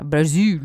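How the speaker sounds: Brazil as bra-ZOO, no hard L; Portuguese pronunciation. Shown as a demonstration. Co-host agreeing on the pronunciation, Portuguese pronunciation.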